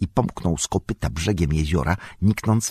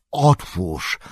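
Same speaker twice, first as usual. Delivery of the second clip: commanding. A narrator's reading. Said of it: "tonem władczym z rosnącym gniewem".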